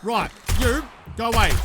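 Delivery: firm loud go away; loud shooing. Real, not acted. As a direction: shout at the zombie to leave. Zombie approaching.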